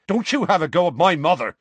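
loud, high snap defending his mother; angry snap. A narrator's reading. Seamus snapping at Harry over his mother, loud and high. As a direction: bite back hard. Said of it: "Seamus snapped".

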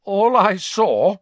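slow, loud, high-pitched insistence; stubborn. A narrator's reading. Ernie trembling but standing up to Harry, stubborn. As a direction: dig in despite the nerves. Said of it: "said Ernie stubbornly".

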